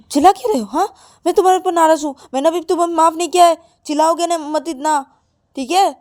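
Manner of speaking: high, loud, indignant voice; cross telling-off. Acted. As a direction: scold a partner you haven't forgiven. Acted telling-off, still cross with partner. (नाराज़गी से डाँटते हुए)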